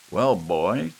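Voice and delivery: a lightly played grandfather, booming; old Gramps. A narrator's reading. Gramps addressing Lou over the mess, loud. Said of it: "said Gramps at last".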